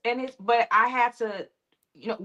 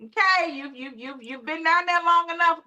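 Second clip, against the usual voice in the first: tough-love pep talk; 8 semitones higher than usual. Retold or imitated. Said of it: "it's like".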